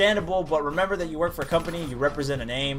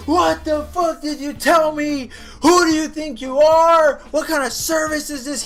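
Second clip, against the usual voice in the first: retold outraged customer, pitched way up; imitated yelling; 9 semitones higher, 4 dB louder than usual. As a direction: ham up the angry customer yelling at the clerk. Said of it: "He's yelling his ass off to me".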